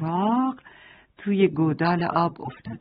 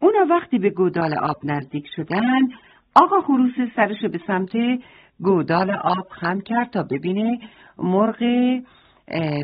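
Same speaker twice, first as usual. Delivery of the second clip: ordinary delivery, nothing marked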